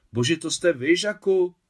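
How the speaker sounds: high, loud astonished recognition; surprised. Acted. Maria suddenly recognising her wartime friend Jacques. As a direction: gasp out his name in disbelief.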